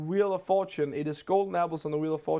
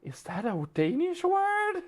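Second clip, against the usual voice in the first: very high, drawn-out mock astonishment; retold shock, stretching the question slowly upward; mind-blown disbelief; 13 semitones higher, slower than usual. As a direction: act out someone's mind exploding. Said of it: "the mind of the person just completely exploded".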